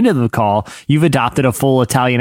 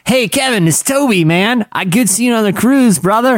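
lightly voiced chummy caller, pitched higher; 8 semitones higher than usual. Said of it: "I'll be like".

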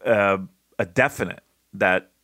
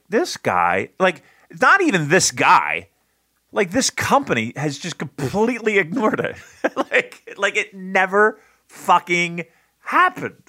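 higher-pitched, incredulous exasperation; exasperated rant; 8 semitones higher than usual. Retold or imitated. Fan venting about the company ignoring it, exasperated. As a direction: climb into exasperated disbelief. Acts out exasperated reaction to match.

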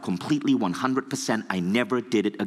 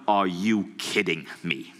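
slow, intense disbelieving growl; stern intense Bob Proctor; 2 semitones lower, slower than usual. Retold or imitated. Bob Proctor scolding the young teacher, slow and intense. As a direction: drag it out like a stern mentor. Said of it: "really stern face ... intense voice".

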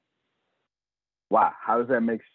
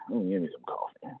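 whispered mock demand; 9 dB quieter than usual.

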